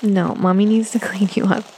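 normal talking voice

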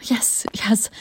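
impatient whispered yes. Acted. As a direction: brush it off under your breath. Impatient aside.

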